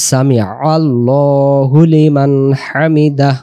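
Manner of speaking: slow Arabic recitation of সামি আল্লাহু লিমান হামিদা. Shown as a demonstration. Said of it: "রুকু থেকে দাঁড়ানোর সময় কি বলবো".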